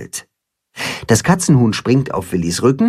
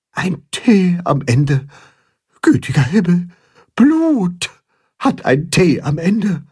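zähneklappernd flüsternd; 7 semitones higher than usual. A narrator's reading. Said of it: "flüstert Grimm zähneklappernd".

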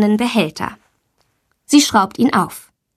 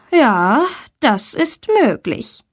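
slow, mildly reluctant concession; a touch of weary teacher; sighing teacher; 4 semitones higher, slower than usual. A narrator's reading. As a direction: draw it out as if after a sigh. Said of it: "Frau Blumberg seufzt".

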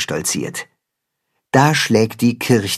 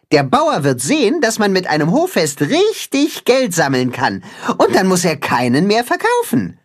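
lightly voiced chicken, higher; explaining; 7 semitones higher than usual. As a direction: explain the plan with a little laugh. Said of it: "erklärt das Katzenhuhn".

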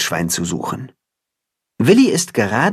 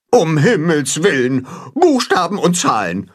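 higher, mildly hammed-up goose alarm; Grimm, clamouring; 5 semitones higher than usual. A narrator's reading. Goose Grimm hears of letters and numbers, clamouring. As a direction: lament as if it were bad news. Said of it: "zetert Grimm".

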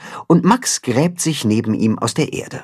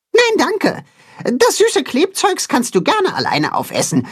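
very high squeaky mole declining; a tiny mole turning up his nose; little mole voice. A narrator's reading. Max refuses strawberries, very high little voice.

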